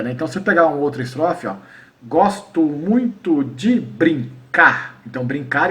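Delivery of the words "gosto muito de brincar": very slow, syllable-by-syllable verse; deliberate metrical scansion; counting syllables. Shown as a demonstration. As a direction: space out each syllable up to the final stress. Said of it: "a contagem da sílaba poética".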